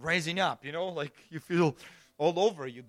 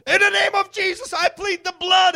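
shouted much higher and louder at the fear; Pentecostal yelling; 12 dB louder than usual. Retold or imitated. Preacher retelling how he shouted at his fears, much higher and louder. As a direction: yell the prayer out in a panic. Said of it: "we were taught to yell at our fears".

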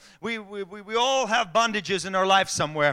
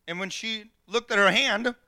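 ordinary talk

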